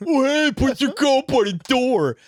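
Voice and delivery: high, loud, slow overdone parroting; mocking deaf guy voice. Shown as a demonstration. Repeating a kind remark back in mocking deaf guy voice. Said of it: "repeat it back and that voice".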